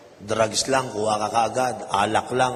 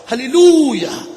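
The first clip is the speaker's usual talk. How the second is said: a high, loud, drawn-out cry of Hallelujah; joyful shout; 12 semitones higher, 12 dB louder, slower than usual. Retold or imitated. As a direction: shout it out in triumph. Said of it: "Napasigaw pa". (sumisigaw ng "Hallelujah" sa tuwa)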